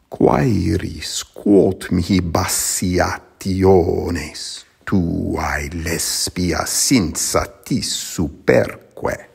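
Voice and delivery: "Quaeris quot mihi bassiationes tuae lesbia sinsatis superque" beaten out slowly by long and short syllables; scanned in meter. Shown as a demonstration. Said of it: "scanned in meter".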